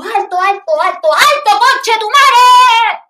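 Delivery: building to a stretched bellow; full scream. Retold or imitated. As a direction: scream the whole street to a halt. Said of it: "grité".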